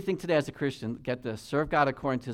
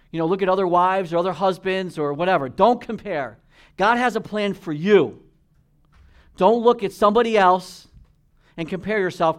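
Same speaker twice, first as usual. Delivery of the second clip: raised, louder and higher, pressing the point home; preaching at full force; 5 semitones higher, 8 dB louder than usual. Real, not acted. Preacher exhorting the congregation not to compare, forceful and loud.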